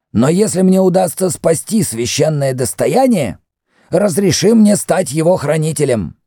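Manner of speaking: high, insistent priest's voice; indignant. A narrator's reading. High-pitched, urgent indignation. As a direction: priest asks to become the labyrinth's guardian, indignant. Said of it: "проговорил с возмущением Мефрес".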